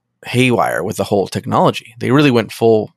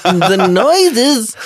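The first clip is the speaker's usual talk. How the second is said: Jerry Lewis; 9 semitones higher than usual. Acted. Podcaster mimics a man reacting to a smoking drink, slapstick outburst. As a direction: go full slapstick clown, high and strained. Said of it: "he goes full on Jerry Lewis".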